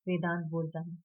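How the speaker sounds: ordinary delivery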